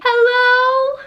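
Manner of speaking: loud high call for help; calling out hello. Retold or imitated. Dreaming girl calls out for help in her room, loud and high. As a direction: shout it out into the dark. Physically saying.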